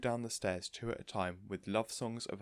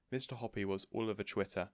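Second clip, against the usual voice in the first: usual speaking voice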